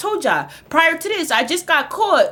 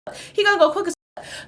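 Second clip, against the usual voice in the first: pleading; 8 semitones higher than usual. Retold or imitated. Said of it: "I'm like".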